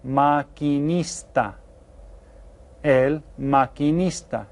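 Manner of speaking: "maquinista" slowly, each syllable clear; slow careful Spanish. Shown as a demonstration. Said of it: "get this one written down".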